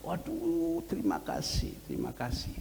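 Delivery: surprised, grateful exclamation, acted; delighted thanks. Aunt thanking a visitor for fish, delighted.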